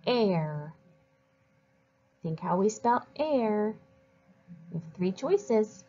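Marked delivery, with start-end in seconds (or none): 0.1-0.7 "P-, air" as a puff of p, pause, then "air"; sounding out. Shown as a demonstration. Teacher helps pupils spell a word, sounding it out. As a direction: separate the p from a drawn-out "air". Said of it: "Think of the sounds".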